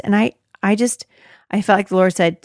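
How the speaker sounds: ordinary delivery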